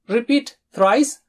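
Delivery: usual speaking voice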